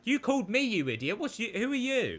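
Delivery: angry shout. Retold or imitated. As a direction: lash out at the caller. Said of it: "so fucking pissed off".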